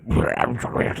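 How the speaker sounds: garbled wordless noise burst; mock noise-track racket; imitated noise music. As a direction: mimic chaotic noise with the mouth. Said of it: "it was just going like".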